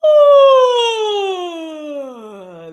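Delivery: loud high yawning sigh, sliding down; stretching yawn sigh; big yawny sigh. Shown as a demonstration. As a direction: let out a huge open yawn. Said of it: "how about a big yawny breath".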